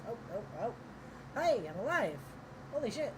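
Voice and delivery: sudden loud yelp of surprise, pitch jumping up; surprised outburst. Real, not acted. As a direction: blurt it out as the camera springs back to life. Camera suddenly starts working again.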